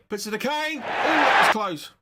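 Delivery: high, rising shout as the chance comes, then a loud "ooh"; excited commentary. Real, not acted. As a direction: burst out loudly at a near miss. Live near miss at goal.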